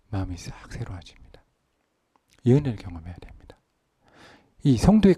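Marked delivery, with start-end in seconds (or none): 0.1-1.4 soft, low whispered assurance; whispered promise. Acted. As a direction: hush it almost to nothing. (속삭이듯 전하는 약속)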